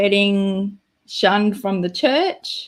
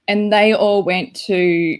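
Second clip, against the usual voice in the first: usual speaking voice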